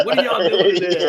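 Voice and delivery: hammed-up parent yell; mock mom yell. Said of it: "Your mom's yelling at you".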